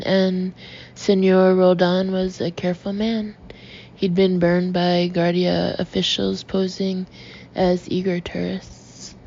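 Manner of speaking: ordinary delivery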